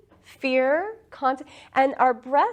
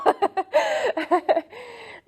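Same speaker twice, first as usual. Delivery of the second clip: a big, high burst of real laughter; 12 semitones higher than usual. Real, not acted. Teacher laughing at her own nutshell summary of life, high and bright. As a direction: let out a high, delighted laugh.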